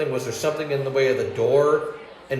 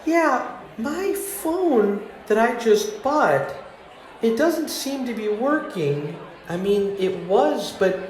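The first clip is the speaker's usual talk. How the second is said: much higher, slow, innocent sweetheart voice; sugary fake-nice caller, high and slow; fake sweet voice; 8 semitones higher than usual. Shown as a demonstration. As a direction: play the helpless sweetheart so they want to help. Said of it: "go like this instead".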